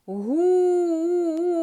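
Гу with the У held long, high and loud; drawn-out syllable Гу. Shown as a demonstration. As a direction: stretch Гу out so the class hears the vowel. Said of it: "Почули". (протяжне високе Гу)

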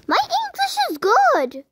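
mocking commenter. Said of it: "Though your English is bad".

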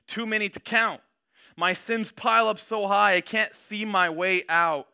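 impassioned scripture reading. Acted. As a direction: cry it out to God, swelling toward please lord. Reads psalm with rising passion.

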